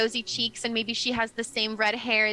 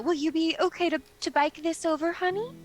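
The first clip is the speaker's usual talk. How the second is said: high, quick motherly question; doting mother; 7 semitones higher, faster than usual. Acted. Mother handing over the flower crate, doting. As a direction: ask sweetly, pitch climbing at the end. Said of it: "she says".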